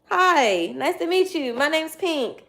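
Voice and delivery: high, forward greeting voice; chirpy introduction. Retold or imitated. Introducing herself to a shy man, high and chirpy.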